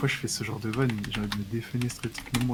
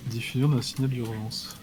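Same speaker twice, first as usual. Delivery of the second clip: usual speaking voice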